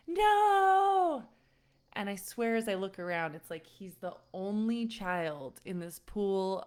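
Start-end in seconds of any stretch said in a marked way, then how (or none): 0.1-1.3 high, loud wailing no; child's scream. Retold or imitated. Son refusing to let mom leave the pool, screaming no. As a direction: scream it like a panicked little kid.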